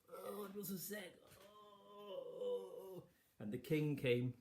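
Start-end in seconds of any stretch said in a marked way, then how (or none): 0.1-3.0 high, moaning, hammed-up invalid; fake sickness. Queen faking sickness to the king, high moaning. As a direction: overplay being ill to fool him. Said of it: "pretended to be sick".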